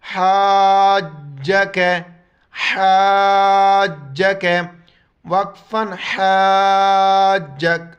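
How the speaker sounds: حاجک with ha from the throat, long a, hard jeem; حاجک: ha from the throat, long a, firm jeem; throaty ha. Shown as a demonstration. Teacher to students, demonstrates throaty ha. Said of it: "ہا کو گلے سے ادا کرنا ہے".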